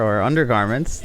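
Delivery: ordinary talk, nothing marked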